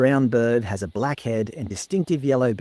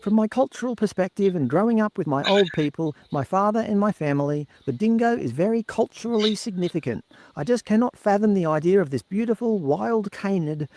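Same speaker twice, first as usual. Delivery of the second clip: quoting Mister Carl; 8 semitones higher than usual. Retold or imitated. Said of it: "Mister Carl commented".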